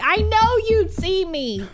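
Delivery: high, slow, drawn-out plea; pleading with the phone. Retold or imitated. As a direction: beg the phone, half joking.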